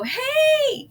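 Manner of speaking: high, bright-pitched friendly "Hey"; cheery hello. Retold or imitated. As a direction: call out a big friendly hello. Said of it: "come up and go".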